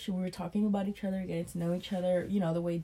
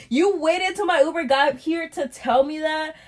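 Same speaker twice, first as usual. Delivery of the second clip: retold outraged retort; 8 semitones higher, 8 dB louder, slower than usual.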